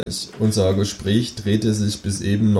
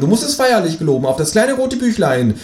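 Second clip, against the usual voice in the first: viel höhere, freche Figurenstimme; Känguru-Stimme; 11 semitones higher, 4 dB louder than usual. Acted. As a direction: das Känguru hoch und keck sprechen. Känguru spricht. (hohe, kecke Känguru-Stimme)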